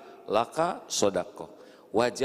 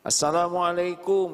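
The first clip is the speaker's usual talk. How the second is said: emphatic greeting; 6 semitones higher, 4 dB louder than usual. Shown as a demonstration. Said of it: "preacher shows greeting delivery".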